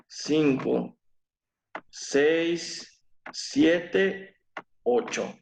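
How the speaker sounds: slow syllable count with pauses. Shown as a demonstration. Teacher counting syllables aloud for students. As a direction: tick off each syllable slowly.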